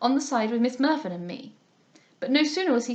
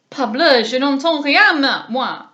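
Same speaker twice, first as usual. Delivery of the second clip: very loud, exasperated French outburst; exasperated cry; 3 semitones higher, 11 dB louder, slower than usual. A narrator's reading. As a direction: throw up his hands and cry out. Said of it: "cried Monsieur Dubois".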